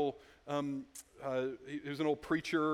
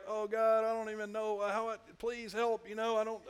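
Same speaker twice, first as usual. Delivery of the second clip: higher, pleading mock prayer, halting; desperate prayer; 6 semitones higher than usual. A congregant's helpless prayer voiced, slightly higher and pleading. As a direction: voice a lost person groping for words to God. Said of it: "your prayers are like".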